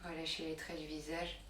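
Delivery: soft, calm yoga-teacher voice with long pauses; guided relaxation. Real, not acted. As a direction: ease them gently into letting go. Leads a relaxation session. (voix douce et posée de relaxation guidée)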